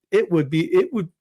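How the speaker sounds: ordinary delivery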